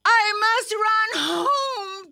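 high, urgent rabbit voice; urgent self-talk. A narrator's reading. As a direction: urge yourself on while being chased. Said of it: "Thumper said to himself".

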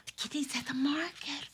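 fast whispered gasp; excited whisper. Acted. Puppet cat spotting the market, whispering fast. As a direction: whisper it with delight.

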